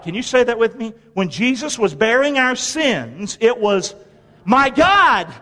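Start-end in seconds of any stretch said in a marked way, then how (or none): 4.5-5.3 high, loud cry to God; impassioned cry. Acted. Preacher leading congregation in Jesus' cry under sin, impassioned cry. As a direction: cry it out with full feeling. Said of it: "I want you to feel it".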